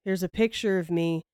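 halting, monotonous presenter impression; Chad Daybell. Host mimicking a boring speaker's slide talk, halting and dull. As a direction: plod through it with long pauses between phrases. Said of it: "but he talks like this".

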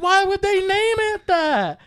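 high, loud, drawn-out imitated yell; shrieked protest. Brother imitating his sister insisting on her point, shrieked protest. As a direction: yell the question back, stubbornly. Acting sister arguing back loudly.

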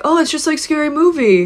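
high-pitched retold reaction; retold realization. Recalling watching Scream as a kid, retold realization.

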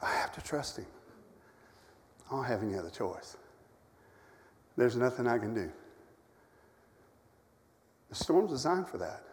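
a sick preacher confessing his trust; near whisper. Real, not acted. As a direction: barely breathe the words.

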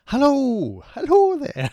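very high, loud bright hello; Lorraine Kelly greeting. Shown as a demonstration. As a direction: call out like a TV host. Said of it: "Lorraine Kelly".

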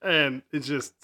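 ordinary talk, nothing marked

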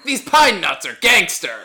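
loud, high, hammed-up TV food host; Guy Fieri. Podcast host imagining a TV chef in the jungle, loud impression. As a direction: shout it like an over-the-top catchphrase. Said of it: "I want Guy Fieri ... and going".

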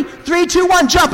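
put-on high, loud kids' countdown; excited countdown. Older siblings teach little sister to jump, excited countdown. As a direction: cheer on a toddler's jump.

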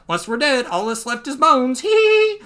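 high, cheerful voice into a giggle; carefree giggler. Acted. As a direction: lift it high, end on a squeaky giggle. Acting Luffy's carefree answer.

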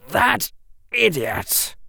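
hissed insult under the breath; tight, exasperated hiss. A narrator's reading. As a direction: hiss it with irritation. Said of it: "Draco hissed".

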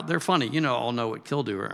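ordinary talk, nothing marked

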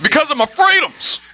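high mocking whine of a freedom guy. Mocking the commenters, a whiny freedom guy. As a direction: lampoon the angry commenter.

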